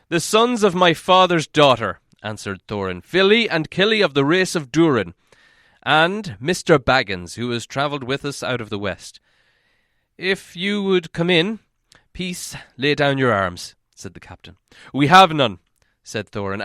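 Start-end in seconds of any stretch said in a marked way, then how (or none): 0.1-9.2 proud, louder introduction; Thorin's voice. A narrator's reading. 10.2-13.7 slow, wary order; a lightly voiced cautious captain; guard captain. A narrator's reading. Captain speaks. 14.9-15.6 loud, curt reply; a blunt dwarf answer; Thorin's voice. A narrator's reading.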